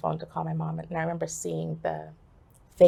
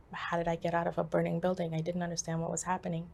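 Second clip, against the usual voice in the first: ordinary talk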